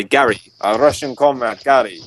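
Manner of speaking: playful put-on Russian accent; mock Russian accent. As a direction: read it like a Russian comrade. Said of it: "That's Russian, yes".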